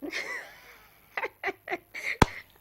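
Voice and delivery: a real, high, loud laughing fit. Real, not acted. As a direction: crack up helplessly at the absurd story. Host bursts out laughing.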